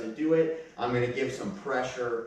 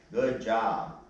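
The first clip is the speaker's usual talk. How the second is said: usual speaking voice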